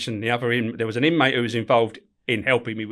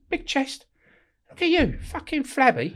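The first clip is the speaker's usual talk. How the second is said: mocking wife; slower than usual. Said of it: "And my missus says to me".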